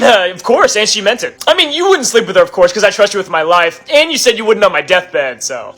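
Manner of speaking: usual speaking voice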